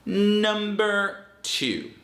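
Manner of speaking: slow, drawn-out high announcement; announcing a heading. Shown as a demonstration. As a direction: present the next point like a title. Announcing list item slowly.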